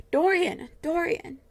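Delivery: crying out his friend's name; anguished cry. A narrator's reading. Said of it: "he cried".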